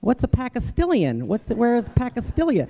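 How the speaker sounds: high, puzzled mispronouncing voice; a confused kid asking about Palestine, pitched high; confused questioner. Retold or imitated. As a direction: mangle the country name, baffled. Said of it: "They go".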